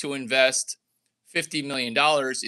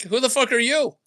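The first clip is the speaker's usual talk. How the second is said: mock indignant retort; 8 semitones higher, 4 dB louder than usual. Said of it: "I'm like".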